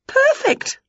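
high, lively witch voice; bright eager witch teasing her pets; Winnie's voice. A narrator's reading. Witch quizzing the dog and Wilbur, high and lively.

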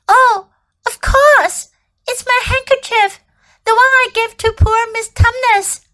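high, delighted recognition; excited cry. Acted. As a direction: gasp it out with joy. Lucy recognizes handkerchief.

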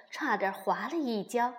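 ordinary delivery

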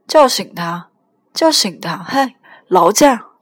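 loud, insistent demand; drunken hollering. Acted. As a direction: holler down the phone, dragging the last words. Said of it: "别吆喝".

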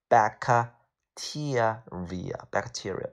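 bacteria slowly, syllable by syllable; sounded out slowly. Shown as a demonstration. Teacher drilling a must-know word, bacteria sounded out slowly. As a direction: break bacteria into slow, separate syllables.

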